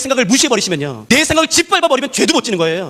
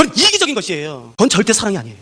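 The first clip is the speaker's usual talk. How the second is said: normal talking voice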